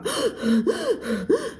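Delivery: sharp high gasp for air; demo gasp. Shown as a demonstration. Teacher acting out the word gasping, sharp gasp. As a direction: suck in air like you can't breathe. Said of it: "gasping ก็คือมีความแบบ".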